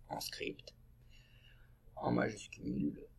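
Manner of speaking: usual speaking voice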